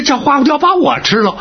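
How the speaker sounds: high, rising echo of an outrageous plan; incredulous objection. Acted. Balks at being the bait.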